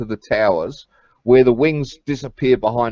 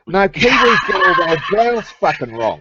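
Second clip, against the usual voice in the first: indignant; 5 semitones higher than usual. Real, not acted. Indignant outburst about Kiwis.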